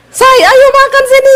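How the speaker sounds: piercingly high summons to eat; imitated Sulawesi wife's shout; high-pitched yelled call. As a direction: holler it high, dragging the last word. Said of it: "Besok begitu lagi".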